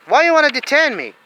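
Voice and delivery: high, loud, outraged question; angry demand. Real, not acted. Detainee demanding why he is held, loud and outraged. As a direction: demand an answer furiously. Rant against detention.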